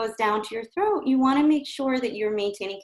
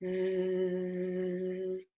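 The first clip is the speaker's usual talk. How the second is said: soft held buzzing V; sustained V; 8 dB quieter than usual. Shown as a demonstration. Coach demonstrating a sustained V sound. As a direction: hold a buzzing V at the lips. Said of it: "So again, sustained sound".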